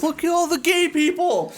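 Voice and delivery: high, awed mock voice; amazed tourist voice. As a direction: gawk at it like a wide-eyed newcomer. Quoting TikToker amazed.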